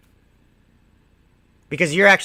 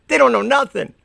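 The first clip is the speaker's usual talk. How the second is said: mock smug laugh-off; 7 semitones higher, 3 dB louder than usual. Walking away from squirrels, lightly smug mock sneer. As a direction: sneer it like you just won. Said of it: "walk away laughing, going".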